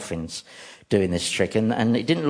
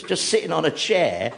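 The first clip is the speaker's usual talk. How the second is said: ordinary talk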